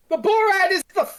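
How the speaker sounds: high, emphatic outburst; exasperated disbelief. Real, not acted. Host revealing the absurd ending, exasperated disbelief. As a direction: hit the punchline in loud incredulity.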